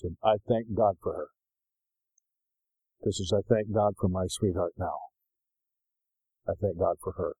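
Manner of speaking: low, soft and heartfelt; tender tribute. Real, not acted. Preacher thanking God for his wife, tender and sincere. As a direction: speak low and gratefully, letting pauses sit.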